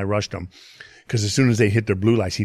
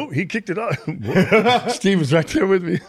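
higher voice breaking into laughter; laughing retelling; 9 semitones higher, slower than usual. Retold or imitated. Podcast guest retelling his friend's reaction, laughing. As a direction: quote a friend through a laugh.

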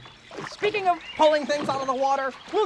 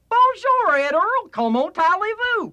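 put-on loud, higher Texan-accented French; 8 semitones higher, 5 dB louder, slower than usual. A Texan greets a friend by phone, in Texan-accented French. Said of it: "speak French like a Texan".

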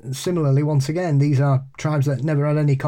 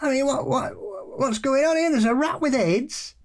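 outraged pub friend; 10 semitones higher than usual. Retold or imitated. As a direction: play the friend baffled and indignant, pitched well up. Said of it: "he came in, he went".

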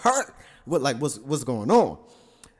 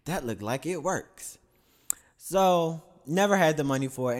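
ordinary talk

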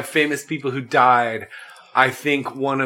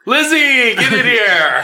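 calling out; 10 semitones higher, 5 dB louder, slower than usual. Pretend. Calling out to passing Elizabeth.

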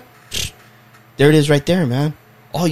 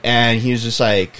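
ordinary talk

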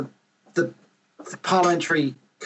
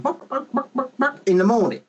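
imitated chicken clucking, higher pitched; chicken noises; 9 semitones higher than usual. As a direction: bop like a hen pottering about. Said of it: "our chickens would be bopping around".

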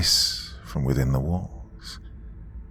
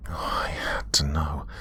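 whispering; 2 semitones lower, 7 dB quieter than usual. A narrator's reading. Whispered desperate realization.

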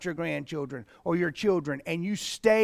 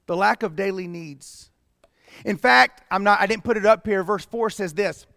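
ordinary talk

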